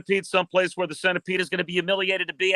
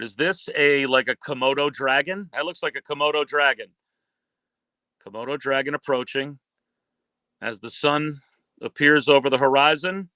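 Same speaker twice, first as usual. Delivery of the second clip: lower, unhurried wildlife-film narrator voice; nature documentary narration; 5 semitones lower than usual. Acted. Calling a lizard versus kangaroo clip for the host, lower documentary narration.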